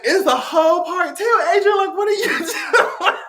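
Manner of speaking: lightly voiced commenter with a laugh; mock viewer. Quoting a viewer teasing her, high and laughing. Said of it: "you girl said".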